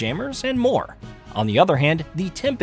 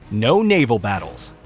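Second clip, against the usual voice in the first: top ten countdown; 4 semitones lower than usual. Acted. List item heading.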